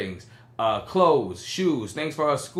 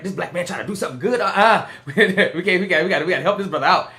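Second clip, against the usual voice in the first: mock auntie voice breaking into laughter; 4 semitones higher, 4 dB louder than usual. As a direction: play the aunties cracking up as they decide to help. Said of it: "They was like".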